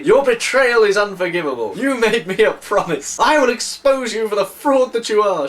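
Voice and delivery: loud, high, furious denunciation; outraged public tirade. Acted. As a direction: shout the threat across the lobby. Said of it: "She said very loudly".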